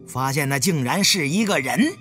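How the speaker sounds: plain reading voice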